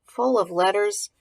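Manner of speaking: usual speaking voice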